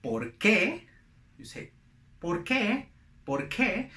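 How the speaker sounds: "¿Por qué?" in Spanish, rising on "qué"; Spanish pronunciation. Shown as a demonstration. Teacher shows learners how Spanish sounds, Spanish pronunciation. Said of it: "when you want to say in Spanish".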